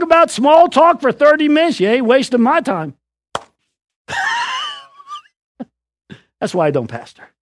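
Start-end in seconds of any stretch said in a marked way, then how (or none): 4.1-4.7 big laugh. Real, not acted. Preacher laughs at own joke.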